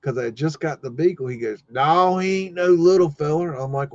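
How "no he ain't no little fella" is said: lightly voiced old farmer, pitched higher; a hint of a contrary country farmer; imitating the farmer's higher-pitched retort; old school farmer. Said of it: "he was old school farmer".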